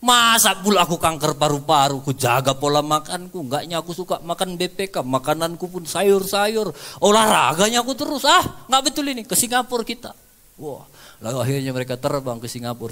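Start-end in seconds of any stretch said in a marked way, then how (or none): 0.0-10.1 loud, high, indignant outburst; shouting, refusing to believe it; angry denial. Retold or imitated. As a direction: rage against the diagnosis as if insulted. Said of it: "bapaknya marah".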